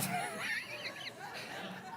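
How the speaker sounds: very high, breathy burst of laughter; laughing shriek at her own story. Real, not acted. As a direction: crack up into a squeal. Cracks up telling birthday story.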